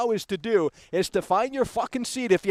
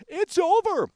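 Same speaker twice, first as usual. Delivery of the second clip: "It's over!" much higher and triumphant; hammed-up triumphant "It's over!"; excited declaration; 10 semitones higher, slower than usual. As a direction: announce "It's over!" like a gleeful verdict.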